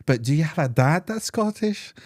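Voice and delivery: quoted stranger. Retold or imitated.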